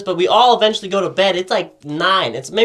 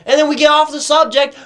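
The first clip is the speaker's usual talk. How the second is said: usual speaking voice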